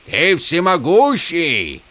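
high, slow, awed-sounding question; high-pitched, slow question; calling out to a god. A narrator's reading. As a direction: call it up to the heavens, drawn out. Hero calls up to god.

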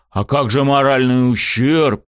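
slow, indignant protest. A narrator's reading. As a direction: bristle at the offer. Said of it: "тут же вскинулся я".

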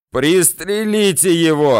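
high, loud, drawn-out yell. A narrator's reading. Bandit chief orders his men to shoot, yelling. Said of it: "орёт Ахмед".

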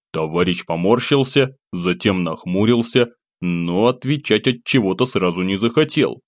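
usual speaking voice

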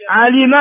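علم as 'alima, kasra on the lam; original verb form. Shown as a demonstration. Teacher listing three verb variants, علم said 'alima. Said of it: "فيه ثلاث لغات فقط".

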